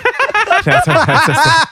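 high, loud cackling laugh; loud burst of laughter. Real, not acted. Cynical furniture theft joke.

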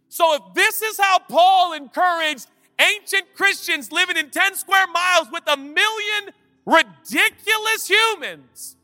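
high, loud, ringing sermon voice; full-force preaching. Acted. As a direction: preach it out to the whole hall.

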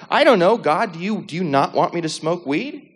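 high retold outburst, rising to a question; exasperated questioning.

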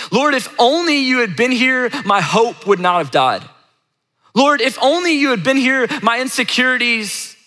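high, pleading cry to God; honest, unfiltered prayer. Pretend. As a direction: voice a heartfelt lament on someone's behalf. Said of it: "it would look like this".

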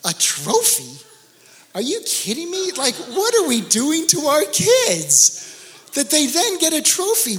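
incredulous. Real, not acted. Pastor scoffing at participation trophies to the congregation, incredulous. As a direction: pitch jumps way up in disbelief.

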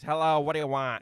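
loud, curt phone greeting; rude receptionist. Retold or imitated. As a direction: bark it like an annoyed receptionist. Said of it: "the woman who answers the phone at Ghostbusters".

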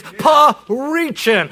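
"preaching" broken into big, drawn-out syllables; bold-letter emphasis. Shown as a demonstration. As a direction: blow up "preaching" like a neon sign. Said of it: "I'm talking bold letters".

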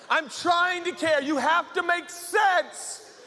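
high, strained, drawn-out shout; yelling. Acted. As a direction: yell back in acted frustration. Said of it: "Don't yell at me".

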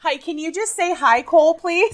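high, loud, overexcited begging; gushing fan plea. Acted. Fan asking actor for a video, gushing plea. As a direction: squeal it like a starstruck fan.

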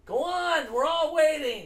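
high, loud heckling; loud teasing shout. Real, not acted. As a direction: egg him on loudly. Taunting him to sing.

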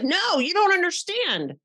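high, protesting put-on voice; indignant protest. Host acting out someone denying any blame, in a high protesting voice. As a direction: squeal it in mock denial.

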